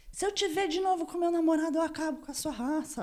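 high-pitched imitation of a jealous threat; mock threat. Victim quoting her attacker's threat about a boyfriend, mock threatening.

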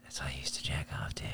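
hushed confessional whisper. Real, not acted. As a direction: drop to a secret whisper. Embarrassed confession aside.

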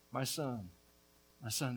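soft, low grieving repeat; preacher's quiet, acted sorrow; subdued lament. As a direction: let the cry sink to a hushed mourning. The king's lament dying away.

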